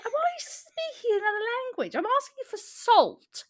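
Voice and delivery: high-pitched, exasperated protest; incredulous exasperation. Acted. Misunderstood customer asking for salt, incredulous. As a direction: protest as if nobody understands plain words. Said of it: "And you feel like".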